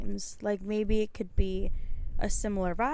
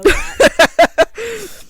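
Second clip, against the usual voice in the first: loud slow emphasis; 11 semitones higher, 14 dB louder than usual. Acted. Joking emphatic admission of ignorance.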